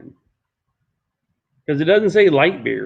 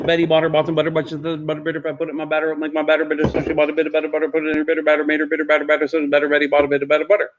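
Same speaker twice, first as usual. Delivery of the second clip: tongue twister demo; much faster than usual. Shown as a demonstration. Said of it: "Betty Botter is a little longer".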